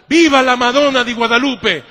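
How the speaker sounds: loud mock cheer of Italian professors; shouted cheer.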